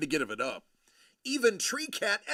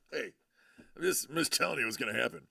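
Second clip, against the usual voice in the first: ordinary talk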